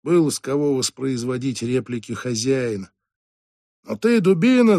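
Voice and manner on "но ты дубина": higher, louder insulting yell; yelling boss. Acted. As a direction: bawl out the janitor. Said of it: "заорал хозяин".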